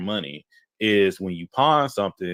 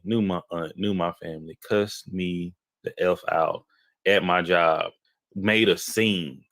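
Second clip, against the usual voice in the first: normal talking voice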